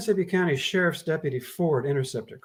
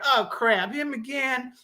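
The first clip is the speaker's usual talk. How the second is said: higher, grumbling complaint; grumping; 10 semitones higher, slower than usual. Acted. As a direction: sigh it out, fed up. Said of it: "Anderson grumped".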